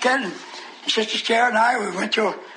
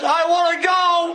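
high, drawn-out demon wail; pleading devil; 7 semitones higher, 3 dB louder, slower than usual. Retold or imitated. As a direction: cry out slowly, begging to leave. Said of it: "All of a sudden it says".

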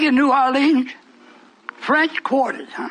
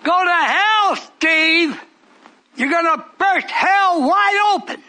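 preaching at full force; 6 semitones higher than usual. Acted. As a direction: thunder it out to the congregation.

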